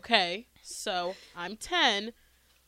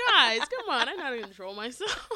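normal talking voice

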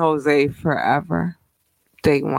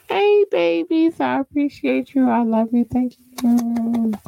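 put-on high baby talk; 9 semitones higher than usual. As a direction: coo to the fans in a high sweet voice. Greeting fans lovingly.

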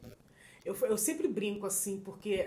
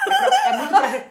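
high, loud laughter breaking through; burst of laughter; 14 dB louder than usual. Real, not acted. Laughing at the odd restaurant slang. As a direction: laugh hard while trying to explain. Hosts crack up over slang.

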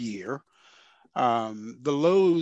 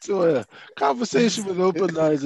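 excited; 6 semitones higher, 8 dB louder than usual. Real, not acted. Energetic podcast intro greeting.

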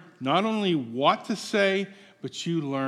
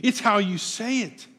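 normal talking voice

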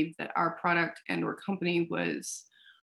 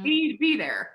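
pushing hard, a shout on be; insistent; 6 semitones higher, 4 dB louder than usual. Retold or imitated. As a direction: demand it, raising the voice. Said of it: "And we're like".

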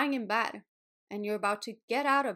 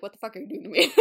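laughing mock outrage from the body; body complaining; 8 dB louder, much faster than usual. The pelvis complaining to its owner on waking, laughing mock outrage. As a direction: play your aching body scolding you through a laugh.

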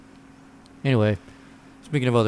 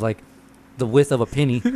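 ordinary talk, nothing marked

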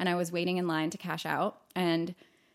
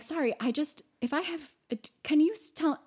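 halting, high-pitched stammer; stuttering; 7 semitones higher than usual. Retold or imitated. Asking a stranger to explain the demon, stuttering imitation. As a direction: imitate yourself tripping over an apologetic question. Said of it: "I'm stu-, I'm stuttering".

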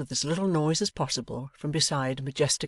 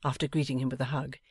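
usual speaking voice

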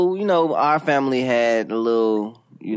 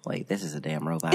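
whispered aside; 8 semitones lower, 9 dB quieter than usual. Retold or imitated. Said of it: "caller voiced, whispered".

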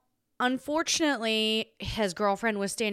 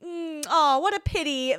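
mock sympathy; 8 semitones higher than usual. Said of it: "I'm just going".

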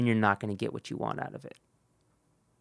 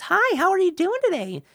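much higher, louder, sing-song sales greeting; fake salesman voice; 10 dB louder than usual. Mocking a gimmicky salesman's greeting, high and loud. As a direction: put on a chirpy fake-friendly pitch. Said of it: "Why are you talking like that?".

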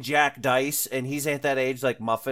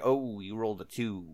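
flat, softer let-down tone; disappointed; 4 semitones lower, 7 dB quieter than usual. Retold or imitated. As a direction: deflate it, lower and quieter. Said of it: "And other times it's like".

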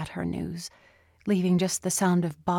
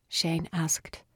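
usual speaking voice

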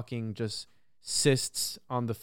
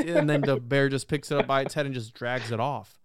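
ordinary talk

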